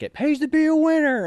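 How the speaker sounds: high, loud taunting voice; teasing drill-sergeant jibe; mock sergeant taunt. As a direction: gloat for the sergeant. Sergeant mocking the others.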